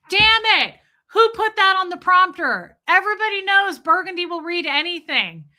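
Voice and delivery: high, loud frantic yell; mock panic at the prompter slip; mock newsroom outburst. Mimicking the Anchorman boss yelling about the prompter. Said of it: "Ron Burgundy right now when they're like".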